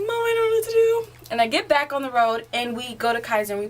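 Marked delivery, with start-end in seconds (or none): 0.0-1.3 high-pitched, helpless wail to mom; mock-panicked teen appealing to mom; panicked plea. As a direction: cry out high, at a loss. Panicking new driver calls to mom.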